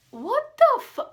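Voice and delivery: high, loud, cut-off outburst; acted-out indignant shock; shocked disbelief. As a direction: squeal it in outrage and break off before the swear. Acting out shocked reaction to remark.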